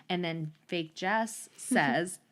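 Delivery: ordinary delivery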